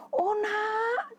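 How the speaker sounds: high, drawn-out storybook mother; concerned mother. Acted. Mother answers crying child in story.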